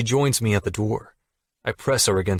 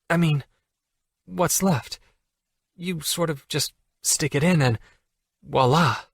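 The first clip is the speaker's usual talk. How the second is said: higher female voice, flustered; flustered Allie; 3 semitones higher than usual. Acted. Allie to Jack about sex, higher and flustered. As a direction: babble it out, embarrassed.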